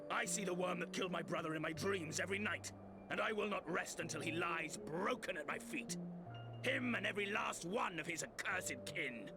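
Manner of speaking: high-pitched, impassioned oath of revenge; vengeful vow. Acted. Mourner swears revenge on a brother's killer, vengeful.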